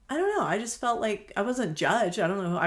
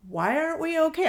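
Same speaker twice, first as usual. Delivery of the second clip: pitched up high, a loud baffled why; surprised question; 10 semitones higher, 6 dB louder than usual. Retold or imitated. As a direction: ask it as if truly thrown by the idea. Retold puzzled reply to customer.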